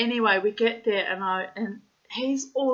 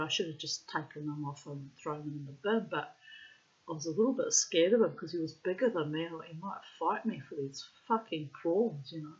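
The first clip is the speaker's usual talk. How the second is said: usual speaking voice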